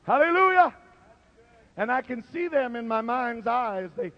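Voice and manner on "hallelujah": high ringing hallelujah; shouted hallelujah. Real, not acted. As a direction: cry it out in praise. Shouted praise.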